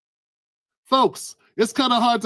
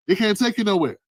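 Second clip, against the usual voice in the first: ordinary talk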